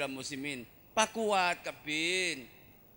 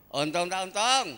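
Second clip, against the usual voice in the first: rhetorical call; 5 semitones higher, 10 dB louder than usual. Acted.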